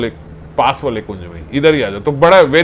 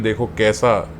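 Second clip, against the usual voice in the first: lightly voiced elder; 4 semitones lower, 4 dB quieter than usual. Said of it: "बाबा बोल रहे हैं".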